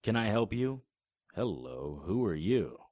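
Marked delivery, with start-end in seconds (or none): none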